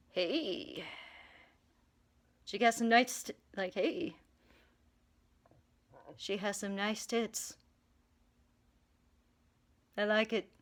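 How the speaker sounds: sleazy hushed remark. Retold or imitated. Hades muttering to himself in church, quietly leering. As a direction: murmur it like a lecher in church. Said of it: "Hades would be like".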